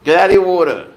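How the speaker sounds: real scolding call. Real, not acted. Owner telling pet off at the water bowl, scolding call. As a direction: call out sharply to stop the animal.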